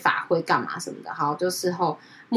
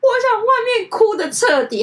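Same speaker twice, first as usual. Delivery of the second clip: much higher, louder, tearful voice on 我想外面哭得彻底; crying quote; 7 dB louder than usual. Retold or imitated. Senior tells friend she wants to cry outside, sobbing. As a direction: sob out 我想外面哭得彻底 high and loud. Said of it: "她就哭着跟我说".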